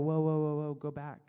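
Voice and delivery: low, loud run of whoas; imitated interrupting listeners. Puzzled followers stopping Jesus to ask, interrupting. Retold listeners halting the teacher.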